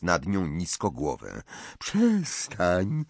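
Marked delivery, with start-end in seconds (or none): 1.8-3.0 high rising moan on „Przestań"; moaning Myrtle. A narrator's reading. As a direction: whine it upward like a wailing ghost. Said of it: "jęknęła jęcząca Marta".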